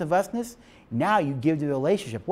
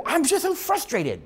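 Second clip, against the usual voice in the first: frustrated whine; 12 semitones higher than usual. Retold or imitated. Voicing himself to God.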